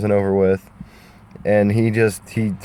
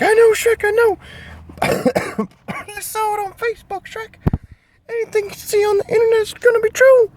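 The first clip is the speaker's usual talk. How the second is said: Donkey voice. Acted. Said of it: "Donkey was like".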